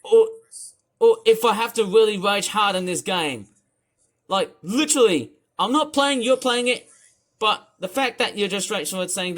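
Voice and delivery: ordinary talk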